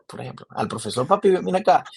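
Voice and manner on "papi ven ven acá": laughing mock Zulian call, a bit higher and louder; joking Zulian papi. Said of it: "Yo le decía". (llamando al profesor "papi" entre risas, con tonito zuliano)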